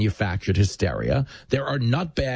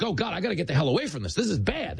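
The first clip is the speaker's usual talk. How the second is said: higher, fast mock panic; a hurried, worried aside; flustered tourists; 7 semitones higher, 3 dB quieter, faster than usual. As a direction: act out tourists wanting out. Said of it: "And they're like".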